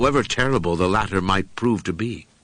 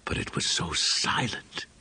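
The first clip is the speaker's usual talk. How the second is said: hushed whisper; 6 dB quieter than usual. A narrator's reading. Narrator hushes at horror.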